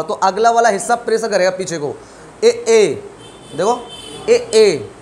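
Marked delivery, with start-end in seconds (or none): 2.4-4.9 ए, ए pressed from the front of the tongue; vowel demo. Shown as a demonstration. Teacher demonstrating ए to students. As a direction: push the tongue and sound ए, ए. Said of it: "है ना, मारा pressure".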